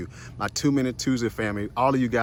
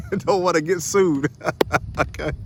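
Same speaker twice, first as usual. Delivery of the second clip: laughing; 5 semitones higher than usual. Real, not acted. Laughing joke about being sued.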